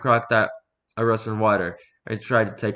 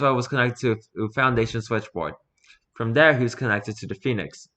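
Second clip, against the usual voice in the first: plain reading voice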